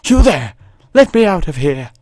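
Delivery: high, loud British woman calling out; commanding high-pitched British accent. Acted. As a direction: put on a demanding British lady voice. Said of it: "I don't know why she's British".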